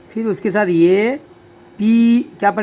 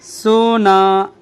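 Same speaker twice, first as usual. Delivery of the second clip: slow, drawn out; 3 dB louder, much slower than usual. Shown as a demonstration. Teacher modelling सोना for students, slowly drawn out. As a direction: read सोना slowly, vowels held long. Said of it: "कूदा।".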